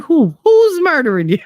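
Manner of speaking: high-pitched mock disbelief; imitated incredulity. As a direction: mimic an exasperated son, pitch shooting up. Son's incredulous reply, retold.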